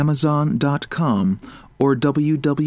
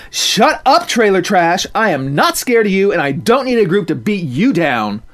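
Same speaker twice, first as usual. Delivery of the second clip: high, loud yell; shrill bully yell; 7 semitones higher, 5 dB louder than usual. Acted. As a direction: shout her down. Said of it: "Gloria yelled".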